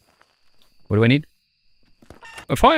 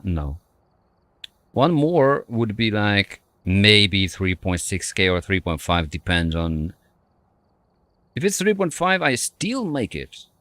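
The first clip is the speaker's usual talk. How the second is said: normal talking voice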